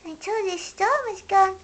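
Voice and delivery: high, small hedgehog voice; a tiny creature talking to himself, high up; Little Hedgehog. A narrator's reading. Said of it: "he said at last".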